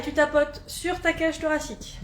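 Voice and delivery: ordinary delivery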